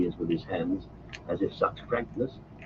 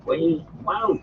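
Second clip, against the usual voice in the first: cried out; 8 semitones higher than usual. Acted. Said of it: "he cried".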